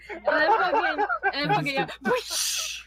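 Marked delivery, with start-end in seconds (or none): 2.0-2.8 airy high whoosh; whoosh sound effect. Retold or imitated. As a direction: mimic a car flying off. Imitating crash sound.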